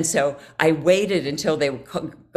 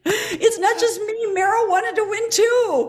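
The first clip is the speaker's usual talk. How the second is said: giddy relief; 11 semitones higher than usual. Retold or imitated. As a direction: act out a thrilled revelation, pitched high. Acts out her giddy relief.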